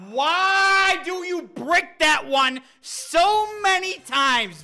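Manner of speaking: slow, loud, high-pitched complaint; exasperated shout. Real, not acted. Gamer scolding the player character, exasperated shout.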